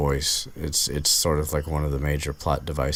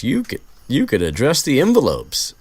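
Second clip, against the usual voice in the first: higher, louder mock Lockhart; imitating Lockhart; 9 semitones higher, 7 dB louder than usual. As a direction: put on a lofty Lockhart voice. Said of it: "and Lockhart's".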